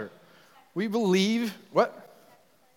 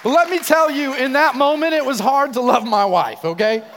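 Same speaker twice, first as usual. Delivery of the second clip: emphatic; 8 semitones higher, 9 dB louder than usual. Real, not acted. Preacher punching the confession.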